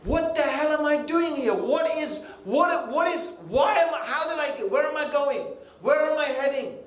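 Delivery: high-pitched, agitated self-questioning; urgent questioning. Acted. Voices people questioning their existence.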